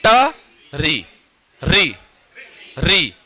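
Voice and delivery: री with the tongue curled back like ट; slow drawn-out ट, री; retroflex ri demo. Shown as a demonstration. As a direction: model री the ट way. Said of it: "री को बोलना है ट जैसे".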